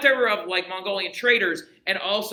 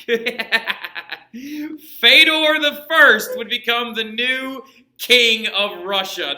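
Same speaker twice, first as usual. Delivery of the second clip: laughing reveal; 5 semitones higher, 8 dB louder, much slower than usual. Real, not acted. Laughing reveal of absurd heir.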